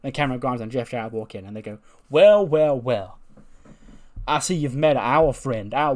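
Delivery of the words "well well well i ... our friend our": loud, drawn-out gloating greeting; gloating call. Retold or imitated. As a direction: announce yourself smugly. Grimes entering with a gloating greeting.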